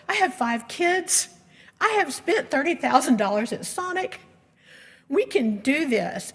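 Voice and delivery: determined resolve. Retold or imitated. Inner thought, deciding to fund it.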